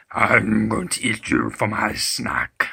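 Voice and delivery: deep slow menacing monster; slow, low giant-troll threat; troll cat voice. Acted. Imagined troll cat threatens them.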